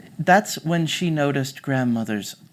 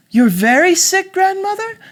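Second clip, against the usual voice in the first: put-on surprised little girl; 10 dB louder than usual. Girl questions sick grandmother.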